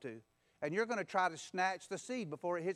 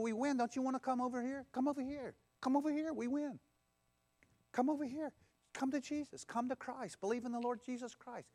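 higher, gently pleading call to come over; coaxing invitation; 6 semitones higher than usual. Acted. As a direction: beckon them like calling friends across. Calling people to come over.

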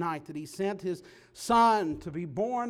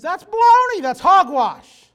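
loud, much higher burst of scorn; indignant shout; 9 semitones higher, 14 dB louder than usual. Real, not acted. Preacher to congregation, shouting scorn at false teaching. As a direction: blast the lie with outrage.

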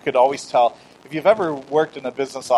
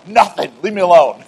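mock bad-mood snap at a spouse; acting out waking up cross; grumpy snap; 3 semitones higher, 6 dB louder than usual. Grumpy morning exchange with wife.

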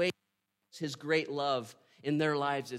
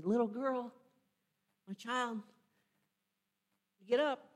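gentle wake-up call; 7 semitones higher than usual. Shown as a demonstration. Jesus waking the dead girl like a napping kid, gentle. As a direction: coax a child out of a nap. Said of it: "That's how he says it".

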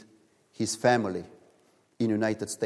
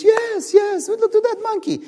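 put-on excited kids; 7 dB louder than usual. Kids cheering at the monkey.

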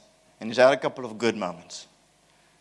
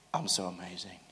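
much softer, under the breath; soft private gloat; 5 semitones lower, 12 dB quieter than usual. Pretend. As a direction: hush it as if only to yourself. Repeats boast to herself softly.

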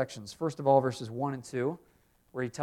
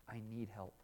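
put-on much softer, a child's quiet ask for help; small child's plea; 5 semitones lower, 19 dB quieter than usual. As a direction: say it softly like a small child to mom. Child asking parent for help.

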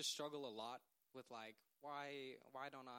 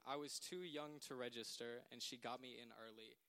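ordinary talk, nothing marked

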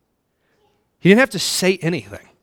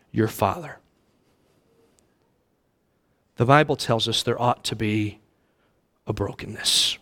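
hushed confession; 4 semitones lower, 4 dB quieter than usual. Acted. Preacher confessing how he hurt his father, softly and low. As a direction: let the hurt sink in quietly.

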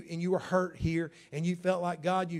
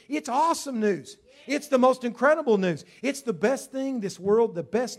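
excited proclamation; 5 semitones higher, 7 dB louder than usual. Real, not acted. Preacher climax on good news.

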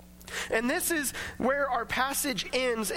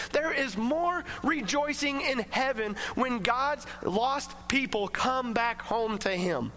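ordinary delivery

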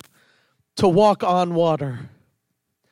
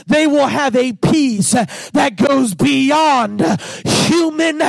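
full-force preaching; 4 semitones higher than usual. Acted. Preacher proclaiming peace beyond comprehension, fervent climax. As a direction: lift the church with rising fervour.

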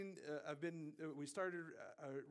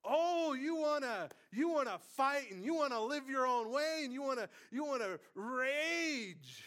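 mocking taunt; 12 semitones higher, 15 dB louder than usual. God jeering at rebels who fight him, mocking. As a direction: stretch each jeer out, loud and high.

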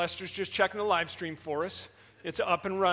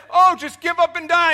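high, loud answer; hard of hearing frog; 10 semitones higher, 15 dB louder than usual. Acted. Deaf frog loudly answering the frogs up top.

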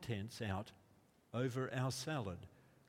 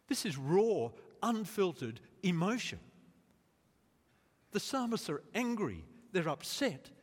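higher, louder, building sermon voice; impassioned preaching; 5 semitones higher, 5 dB louder than usual. Acted. Pastor stirring the congregation, impassioned louder preaching. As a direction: preach it with fire.

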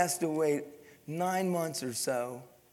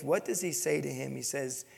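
ordinary delivery

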